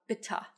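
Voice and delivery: 'bitte' pronounced bitt-ah; A schwa. Shown as a demonstration. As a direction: say 'bitte' ending on a short a. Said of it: "and the A schwa".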